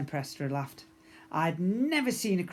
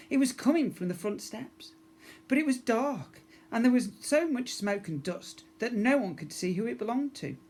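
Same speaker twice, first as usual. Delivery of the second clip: tense narration; 8 semitones higher, 3 dB louder than usual. A narrator's reading. Suspense narration of mysterious voice.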